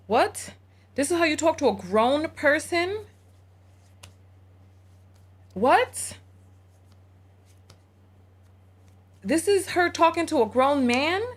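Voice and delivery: can't believe what he's hearing; indignant disbelief. Real, not acted. Host reacting to how she talks to a grown man, indignant disbelief.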